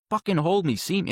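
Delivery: raw, pleading demand; desperate plea. Retold or imitated. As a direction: cry out to be held, pleading. Begging his dad on the ground.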